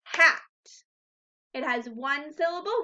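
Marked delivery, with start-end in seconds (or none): 0.0-0.4 Hat called out louder in one clapped beat; clapping the word. Shown as a demonstration. Teacher claps Hat aloud for class to copy. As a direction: say Hat loud on one clap. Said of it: "Say the word hat with me and clap".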